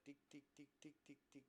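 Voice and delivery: soft, evenly spaced ticking; quiet mechanical tick-tick; imitated dot beeps. Shown as a demonstration. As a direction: mimic a computer dot hopping along. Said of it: "점이 팅 하면 눈이 이렇게".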